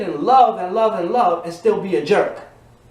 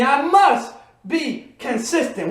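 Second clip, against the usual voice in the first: slow, higher, must leaned on; slow declaration; 4 semitones higher, slower than usual. Shown as a demonstration. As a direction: call it out slowly once more. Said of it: "One more time".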